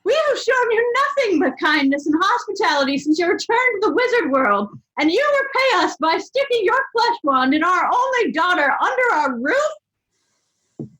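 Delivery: high shouted mother's rant; furious scolding. A narrator's reading. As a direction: rage at him, slowing at the end. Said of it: "Molly fumed".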